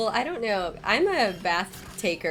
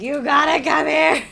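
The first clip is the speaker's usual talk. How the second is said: loud, higher drawn-out yell for help; retold scream; 6 semitones higher, 9 dB louder than usual. Wife calling Travis to the bathtub, retold scream. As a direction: yell it as if summoning someone urgently. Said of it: "screaming for Travis".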